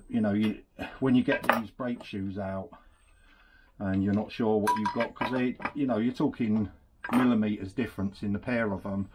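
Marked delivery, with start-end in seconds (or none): none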